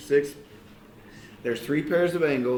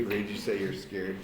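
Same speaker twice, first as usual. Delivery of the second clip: usual speaking voice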